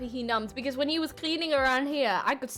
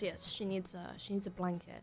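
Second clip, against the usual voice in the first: low, hushed request to the nurse; discreet dentist; 6 semitones lower, 12 dB quieter than usual. Retold or imitated. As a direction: imitate a concerned dentist speaking softly. Dentist discreetly asks nurse for help.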